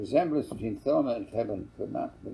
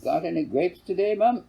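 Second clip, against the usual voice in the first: put-on higher, louder child asking; boy's voice; 8 semitones higher, 5 dB louder than usual. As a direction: pipe up like a cheeky boy. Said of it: "It was Kevin's turn to interrupt".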